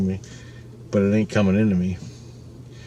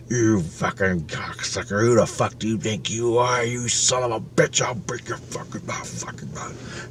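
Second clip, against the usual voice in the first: lightly voiced biker demon, threat trailing into a whisper; menacing demon taunts; 6 dB quieter than usual. The evil biker spirit taunting him, hushed menacing threat.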